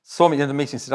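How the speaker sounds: ordinary talk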